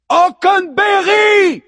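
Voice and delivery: huge high shout, drawn out; goddess shout. A narrator's reading. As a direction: bellow the name like a goddess. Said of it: "hurle-t-elle de sa puissante voix de déesse". (hurlement puissant de déesse)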